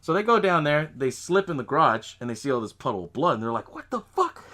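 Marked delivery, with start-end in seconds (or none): 3.7-4.4 high-pitched retold disbelief; mock shocked exclamation. Girls find a puddle of blood, mock shock.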